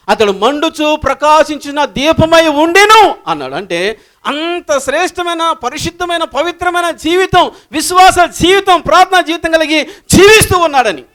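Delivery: high, impassioned sermon delivery; full-force preaching. Acted. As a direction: proclaim it loudly, stretching the key words.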